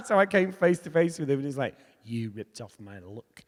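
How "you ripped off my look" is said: put-on lower, slow and soft, a poorly done Liverpool accent; bad Liverpool accent. [name] confronting the speaker on a club staircase, in a bad Liverpool accent. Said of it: "it's a really bad Liverpool accent".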